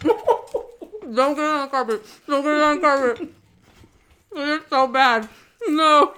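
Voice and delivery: laughing with mouth full of crackers; eating dry crackers. Real, not acted. Saltine challenge, laughing mouthful.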